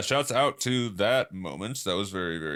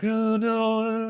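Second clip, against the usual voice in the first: very high bashful "oh no"; squeaky embarrassed refusal; high flustered girl voice; shy protest; 14 semitones higher, 5 dB louder, slower than usual. Retold or imitated. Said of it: "she was like".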